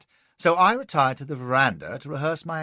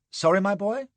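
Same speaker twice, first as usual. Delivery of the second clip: pleasant; 8 semitones higher than usual. A narrator's reading. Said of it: "says I pleasantly".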